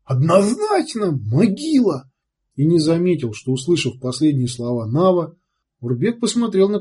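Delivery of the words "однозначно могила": higher, slow, gleeful squeal; happy squeal. A narrator's reading. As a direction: squeal it out in delight. Уйбуй радостно повторяет.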